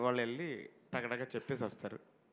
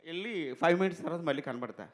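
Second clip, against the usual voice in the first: usual speaking voice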